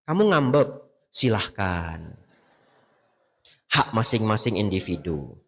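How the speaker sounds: quoted dismissal. Retold or imitated. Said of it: "kata Bante Abijato".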